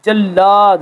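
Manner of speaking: جلاد with the l held double, jal-laad; doubled l. Shown as a demonstration. Teacher modelling the shadda on lam, doubled l. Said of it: "لام کو دو مرتبہ پڑھ رہے ہیں".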